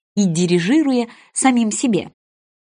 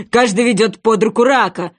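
mildly raised sea wolf voice; calling out; 4 dB louder than usual. A narrator's reading. Sea wolf shouts dance steps, mildly raised. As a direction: call it out firmly, without screaming. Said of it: "прокричал морской волк".

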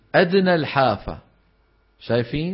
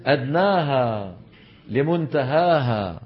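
drawn out slowly like a recited verse; slow recitation; slower than usual. Shown as a demonstration. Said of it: "قال الجزري". (إلقاء بطيء لبيت الجزري)

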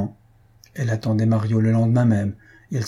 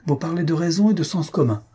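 high, mock-admiring exclamation; forced gaiety; 7 semitones higher than usual. A narrator's reading. Rejected suitor applauds the countess's good sense, forced gaiety. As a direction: cheer her with false delight. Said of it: "avec une gaieté forcée".

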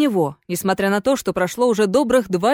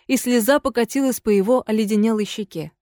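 ordinary delivery

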